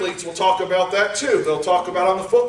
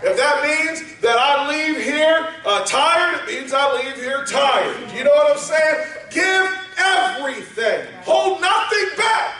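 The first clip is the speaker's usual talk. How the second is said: rising, shouted sermon climax; preaching at full force; 8 semitones higher than usual. Acted.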